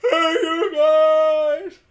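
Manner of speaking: slow, drawn-out, very loud, high call; mock Sloth yell at the gang; Sloth shout. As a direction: bellow it out like Sloth. Goonies quote shouted.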